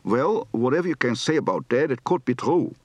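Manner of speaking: strong Dutch accent, d for th at the end; thick Dutch-accented English; heavy Dutch accent. Shown as a demonstration. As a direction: lean into the Dutch sounds. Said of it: "Heavy accent".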